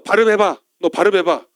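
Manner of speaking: acted interrogator's order. Guard testing a fugitive at the crossing, loud commanding tone. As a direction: order them to speak, firmly and loudly.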